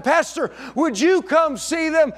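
put-on a kid pleading for the pastor to come; eager kid calling out. Child asking pastor, calling out.

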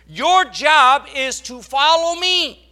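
loud, slow, high preaching command; forceful command. Acted. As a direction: drive it home like a fiery preacher. Preacher voicing Christ, forceful.

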